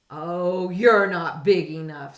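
loud, scornful brush-off; grouchy dismissive. A narrator's reading. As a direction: wave them off loudly. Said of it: "said the grouchy ladybug".

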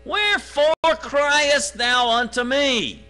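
loud, high, slow booming voice of God; God thundering down at Moses, slow and loud; God speaking. Acted. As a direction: boom it out slowly as the Almighty. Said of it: "God speaking".

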